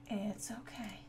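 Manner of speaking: soft whisper to yourself; calm yourself down in a whisper; whispered reassurance. Real, not acted. Reassuring self after mistake.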